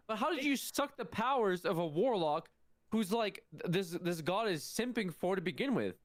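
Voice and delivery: ordinary talk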